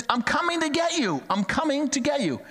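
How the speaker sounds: high, urgent calling voice; dad calling out to his son; urgent reassurance. Retold or imitated. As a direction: reach out to the scared boy. Dad reaching son on roof.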